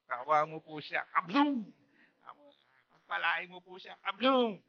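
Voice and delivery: sweet prayer, then a loud mock gunshot; mock blessing before shooting. Preacher mocking a soldier's blessing, mock prayer then gunshot.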